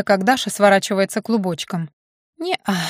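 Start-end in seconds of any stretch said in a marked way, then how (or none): 2.4-2.8 drowsy, mumbled no; sleepy mumble. A narrator's reading. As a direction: mumble it half asleep. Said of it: "сонно пробормотала она".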